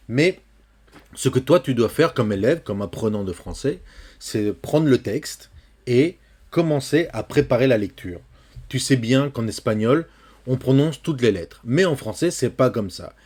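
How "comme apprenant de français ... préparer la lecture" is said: usual speaking voice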